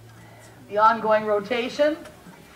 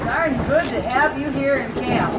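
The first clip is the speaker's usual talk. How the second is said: hearty prospector greeting; 5 semitones higher, 5 dB louder, slower than usual. Acted. Costumed docent greets visitors.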